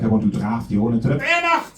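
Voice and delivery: lightly put-on harsh German accent. Comic mocking his own German accent to the crowd. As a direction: bark it like a German officer, half joking. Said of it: "a German accent in English sounds very harsh".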